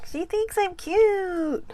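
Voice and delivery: high, slow cartoon reindeer voice; squeaky little Rudolph voice. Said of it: "my Rudolph the Red-Nosed Reindeer voice on".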